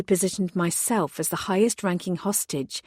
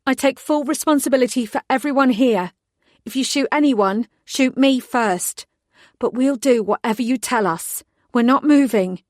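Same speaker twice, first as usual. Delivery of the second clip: higher, louder, firm commanding tone; forced authority; 6 semitones higher, 6 dB louder than usual. Acted. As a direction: take charge of the room, steady and firm. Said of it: "I forced as much authority as I could into my tone".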